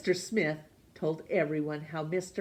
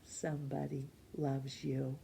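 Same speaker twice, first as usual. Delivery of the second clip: low, soft and hushed; soft card reading; 5 semitones lower, 11 dB quieter than usual. A narrator's reading. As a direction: read the card softly and lower.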